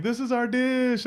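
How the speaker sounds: high, drawn-out mock-excited announcement. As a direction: sing it out proudly in a high voice. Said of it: "they would get so excited for".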